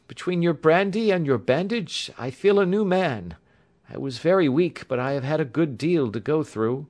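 high-pitched, grateful patient voice; revived young engineer. A narrator's reading. Bandaged patient thanking the doctor, feeling revived.

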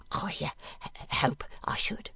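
low, hushed stammer of an awkward man; not knowing what to say. A narrator's reading. Master answering his servant girl, hesitant and unsure. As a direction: fumble softly towards an answer. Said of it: "at first not knowing what to say".

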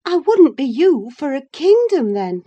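high, loud and emphatic; emphatic declaration. Acted. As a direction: declare it forcefully, dropping on the last word. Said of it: "Catherine declared emphatically".

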